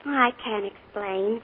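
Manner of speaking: soft, slow and quiet; hushed. Acted. Niece refusing her uncle's gift, hushed and slow. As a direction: barely voice it.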